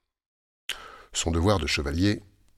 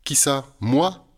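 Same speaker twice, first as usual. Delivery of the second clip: pixie; 6 semitones higher, 3 dB louder, much slower than usual. Acted. Surprised pixie answers.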